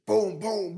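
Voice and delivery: gunshot sounds. Retold or imitated. Imitating gunshots in story.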